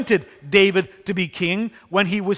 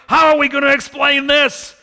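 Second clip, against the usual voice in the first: mock panicked brother; 6 semitones higher, 4 dB louder than usual. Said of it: "I can just see his brothers".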